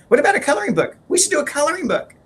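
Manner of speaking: high and loud, bursting with the idea; eager idea. Retold or imitated. Husband imitating wife pitching the coloring book, high excited eagerness. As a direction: sell it like a sudden inspiration. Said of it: "she's like".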